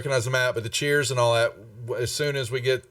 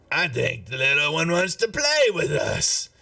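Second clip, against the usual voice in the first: higher-pitched taunting villain voice; taunting foe; 6 semitones higher than usual. Acted. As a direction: draw it out, high and taunting. Game master voices a foe.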